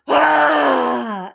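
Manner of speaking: loud, long roaring exhale; silly full-out dragon roar on the breath; pretend dragon roar. Parent breathing out like a dragon, silly roar. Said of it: "I'm roaring out".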